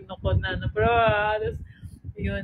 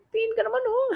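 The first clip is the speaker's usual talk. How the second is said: a high, teary plea; choked-up high plea; crying plea; 12 semitones higher, much faster than usual. Retold or imitated. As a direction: beg through tears, pitch way up. Voicing herself mid-tears.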